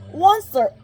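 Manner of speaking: high, loud croaking call; croaking cry. A narrator's reading. Lorax coughing through smog at the Onceler, croaking. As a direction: croak out his name in protest. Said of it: "he cried with a crofulous croak".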